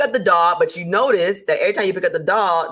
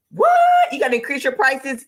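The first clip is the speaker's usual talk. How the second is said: mock shock; 7 semitones higher than usual. Viewer hearing they must raise prices, mock shocked squeal. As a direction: squeal it as if you can't believe it.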